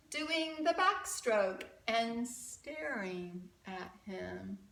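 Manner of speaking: lively rhyming storybook read; reading to children. A narrator's reading. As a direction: read it like a picture book for kids. Rhyming picture book read aloud.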